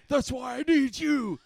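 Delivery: high mock-dramatic movie yell; hammed-up shouting. Hero demanding the password, hammed-up high shout. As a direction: ham up the urgency, high and insistent. Acted hero and sidekick quarrel.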